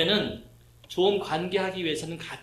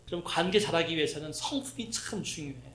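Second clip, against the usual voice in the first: usual speaking voice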